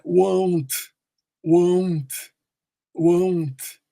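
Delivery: "won't" with a rounded ou glide; ou sound. Shown as a demonstration. Teacher modelling for students, "won't" with an ou diphthong. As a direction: say "won't" slowly with the O as ou. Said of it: "Ele tem esse som de ou".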